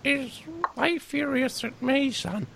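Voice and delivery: put-on high, wavery old man voice; old Pop. Dad asking his son for help, in a high old man voice.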